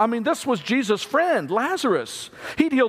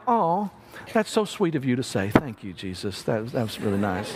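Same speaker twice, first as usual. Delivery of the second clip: soft, lower, brushing it off sweetly; politely dismissive; 8 semitones lower, 4 dB quieter than usual. Retold or imitated. Martha politely brushes off Jesus' comfort, mildly dismissive. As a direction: thank him like it's a polite nothing. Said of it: "that's kind of the tone".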